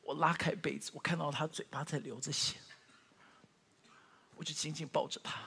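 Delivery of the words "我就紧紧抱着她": normal talking voice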